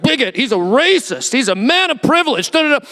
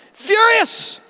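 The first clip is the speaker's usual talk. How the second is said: furious repeated much higher than before it; high echoed furious; 11 semitones higher than usual. Acted. Preacher to congregation, echoing furious up high. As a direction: echo furious up high, dramatic.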